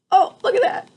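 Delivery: usual speaking voice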